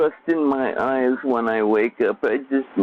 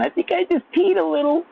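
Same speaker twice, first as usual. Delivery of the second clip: put-on much higher girly voice, rising at the end; giggly girl quote. Mimicking girls to his chat, high squealy girl voice. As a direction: squeal it like a girl who laughed too hard. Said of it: "I think I'd be saying".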